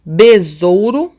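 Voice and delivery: BESOURO drawn out by syllables; slow demonstrative reading. Shown as a demonstration. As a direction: sound out BESOURO slowly. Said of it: "Vamos lá, ó".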